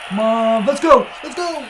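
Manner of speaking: high, loud cheering; rally the team loudly; excited shout. Real, not acted. Cheering during the game.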